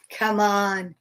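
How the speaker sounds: ordinary delivery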